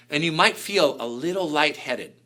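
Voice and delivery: normal talking voice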